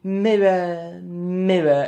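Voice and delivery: mocking a clumsy English accent, lightly; bad English accent. Mocking Charlize Theron's failed English accent as the witch. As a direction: parody a poor attempt at an English accent. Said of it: "She can't do the English accent at all".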